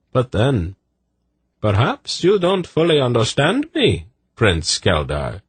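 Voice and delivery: high, lilting reply, dropping on the title; arch eunuch. Acted. Eunuch countering the prince's remark, arch. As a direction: float it up high and knowingly. Eunuch answers Silk's jibe.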